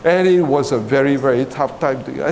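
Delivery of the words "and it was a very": usual speaking voice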